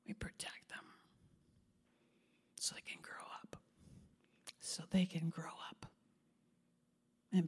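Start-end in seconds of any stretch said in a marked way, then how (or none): 0.0-5.8 almost silent whispered point. Real, not acted. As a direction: whisper it tenderly. Hushed sermon climax.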